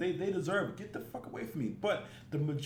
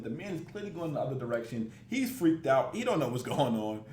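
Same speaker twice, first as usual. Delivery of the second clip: normal talking voice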